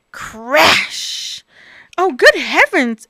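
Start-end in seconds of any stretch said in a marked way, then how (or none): 2.0-3.1 higher, louder startled cry; exclaiming. Acted. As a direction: gasp at the crash. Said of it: "exclaimed Bob".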